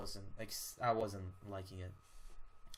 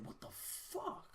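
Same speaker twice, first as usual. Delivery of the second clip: incredulous squeak; 9 dB quieter, slower than usual. Real, not acted. Baffled by the villain.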